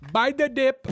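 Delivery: high excited cry of buy the dip; hype shout. Acted. Host hyping his coin to viewers with a high shouted cry. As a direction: yell it like a crypto hype man. Hyped crypto cheer.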